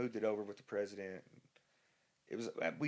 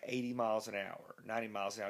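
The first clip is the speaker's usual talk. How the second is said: ordinary talk, nothing marked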